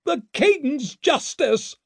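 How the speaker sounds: very high, slow cry of demand; outraged high-pitched scream. A narrator's reading. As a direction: cry it out from a balcony. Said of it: "she screamed from the balcony".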